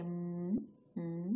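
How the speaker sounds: "উম" as a held hum; slow, humming "উম"; isolated m sound. Shown as a demonstration. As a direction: hold the m in "উম" on closed lips. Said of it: "যেমন ম উচ্চারণ করছি".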